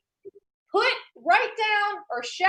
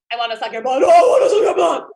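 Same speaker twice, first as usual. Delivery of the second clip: shouted rap-style growl growing louder; 2 semitones lower, 8 dB louder, much faster than usual. Acted. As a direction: bark it out like a rapper.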